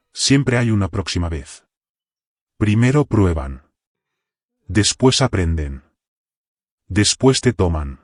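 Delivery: measured, understated deadpan reply; dead calm. A narrator's reading. Draven answers Ward about next time, dead calm. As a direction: answer with deadly calm, in spaced-out sentences. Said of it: "respondió con una calma muerta".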